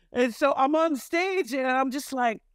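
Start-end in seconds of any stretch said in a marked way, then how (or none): none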